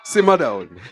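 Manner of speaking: imitating a catchphrase. Candidate quotes a remembered man, low mimicking voice. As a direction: mimic the man who used to say it. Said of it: "That's what he used to say".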